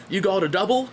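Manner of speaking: put-on high-pitched Australian accent, questioning lift. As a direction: put on a broad Aussie accent. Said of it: "That's my Australian accent".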